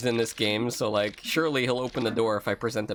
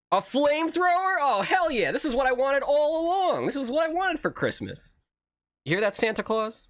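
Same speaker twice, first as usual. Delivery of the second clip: high, gleeful excitement; thrilled; 13 semitones higher than usual. Real, not acted. Streamer finding a flamethrower in the store, gleefully excited. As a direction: crow over the new toy.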